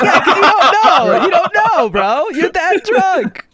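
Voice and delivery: loud, high, slow retort through laughter; mock-exasperated shout. Storyteller re-enacts telling a drunk friend off, loud exasperation. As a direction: drive the point home at full volume.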